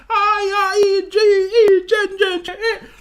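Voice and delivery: very high imitated wail; high-pitched vocal commotion noises; wailing sound effects. Workers' uproar acted out for listeners, wailing sound effects. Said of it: "I'm making a lot of sound effects".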